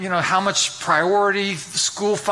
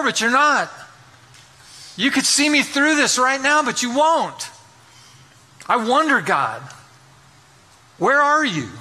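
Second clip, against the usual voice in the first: pleading prayer; 5 semitones higher than usual. Acted. A believer in the waiting cries out to God, pleading. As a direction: cry out to God, begging for an answer.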